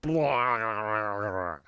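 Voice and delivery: loud mocking blah; cat girl raspberry. Cat girl mocking the troll's order, bratty. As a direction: blow him off with a bratty blah.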